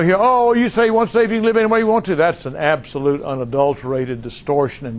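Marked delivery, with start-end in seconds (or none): 0.2-2.1 high-pitched parroting of an objector; mock objection. Preacher voices a critic of once saved always saved, mocking. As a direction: run it off high, mocking the claim. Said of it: "We're not telling, you know".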